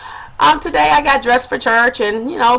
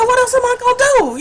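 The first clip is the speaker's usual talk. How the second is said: high-pitched helpless comeback; acted retort; 12 semitones higher, faster than usual. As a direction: throw it back, voice pitched up. Acts out her retort to neighbour.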